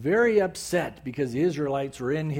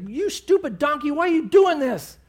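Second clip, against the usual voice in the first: high, louder furious shout at the donkey; angry yell; 10 semitones higher, 5 dB louder than usual. Retold or imitated. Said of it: "beating him and yelling at him".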